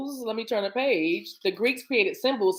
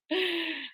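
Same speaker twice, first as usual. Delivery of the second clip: soft high laugh; 10 semitones higher, 7 dB quieter than usual. Real, not acted. Laughs after cards fell.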